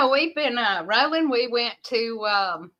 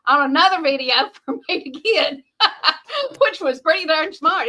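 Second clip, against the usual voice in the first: laughing through the words; giggly, amused telling; 4 semitones higher, 5 dB louder than usual. Real, not acted. Laughing about friend's trick.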